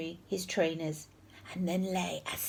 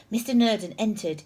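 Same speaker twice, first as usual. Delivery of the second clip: ordinary delivery, nothing marked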